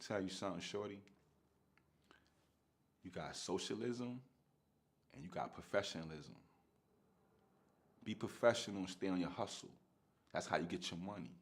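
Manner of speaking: put-on [name] calmly advising a young hustler, softly; Jay's calm advice. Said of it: "And Jay said".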